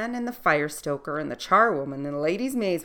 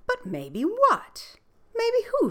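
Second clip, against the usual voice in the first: high, slow prying queen; slower than usual. Acted. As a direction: draw it out, curious and high. Queen pressing the girl.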